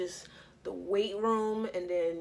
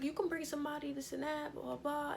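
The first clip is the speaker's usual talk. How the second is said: breezy quoted invite; 8 semitones higher than usual. Retold or imitated. Quoting the host's casual invite.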